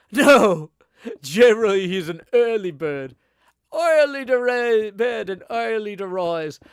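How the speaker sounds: very loud, high, hammed-up British landlord; hard British accent. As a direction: belt it out as a botched British innkeeper. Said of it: "it went really hard British".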